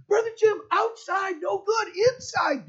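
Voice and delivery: put-on very high, loud children's voices; kids shouting. Kids explaining about the banana.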